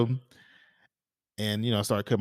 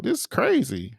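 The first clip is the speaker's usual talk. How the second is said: high, disbelieving exclamation; a hint of New York slang in a high, disbelieving retelling of waiting for his prom date; incredulous; 9 semitones higher than usual. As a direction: pitch way up in amused disbelief. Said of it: "I'm like".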